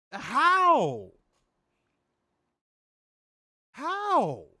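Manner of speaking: high, drawn-out incredulous cry; exasperated. Real, not acted. Podcaster calling out WWE fans, exasperated. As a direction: wail it in disbelief.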